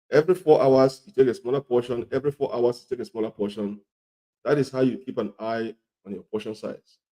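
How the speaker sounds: normal talking voice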